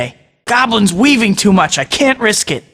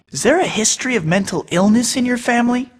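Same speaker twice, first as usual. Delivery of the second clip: ordinary delivery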